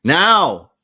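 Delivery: one loud, high-pitched command; sharp parental order. Acted. Parent ordering Queenie to go wash, loud and sharp. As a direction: fire it off as an order to obey.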